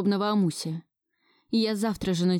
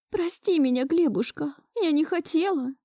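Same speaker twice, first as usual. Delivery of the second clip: high-pitched, lightly tearful grandmother; sobbing apology, understated; 9 semitones higher than usual. A narrator's reading. Grandmother apologising to her grandson, mildly sobbing. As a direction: plead for forgiveness with a touch of a sob. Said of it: "Раздался очередной всхлип бабули".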